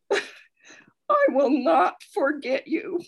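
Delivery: choking up, slow and teary, laughing through tears; tearful. Real, not acted. Reader moved by the verse, slow and tearful. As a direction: voice breaking as the tears come. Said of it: "Oh, I'm gonna cry".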